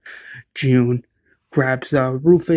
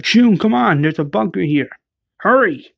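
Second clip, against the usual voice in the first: urgent call; 5 semitones higher than usual. Retold or imitated. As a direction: hurry her into the bunker. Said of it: "Rachel's like".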